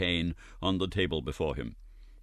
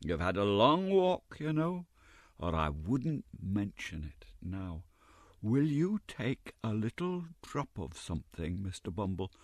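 soft, softer sweet offer of a drink; coaxing Mrs Mann; 6 dB quieter than usual. A narrator's reading. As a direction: wheedle him gently. Mrs Mann coaxes Bumble.

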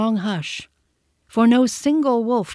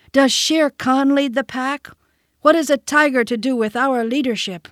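higher, louder defiant question; challenging; 6 semitones higher, 3 dB louder than usual. A narrator's reading. Mowgli challenging the pack about Shere Khan, defiant.

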